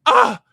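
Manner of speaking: sudden high, loud "Ah!"; startled yelp. Retold or imitated. As the girl realises who he is, sudden high yelp. She recognises him, reacts.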